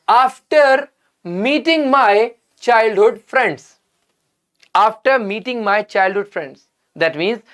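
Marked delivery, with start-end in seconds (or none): 0.1-3.8 very slow, each word laid out for the class; slow careful reading. Shown as a demonstration. Teacher reading a sentence to students, very slow and deliberate. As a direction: read it out slowly so students catch every word. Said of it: "read the second part of the sentence carefully".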